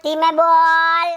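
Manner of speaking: very slow, low-pitched command; slow, low, threatening order; menacing warning. Acted. Repeats the warning in acted quarrel.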